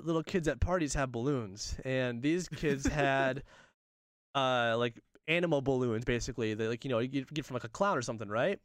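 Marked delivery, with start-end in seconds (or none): none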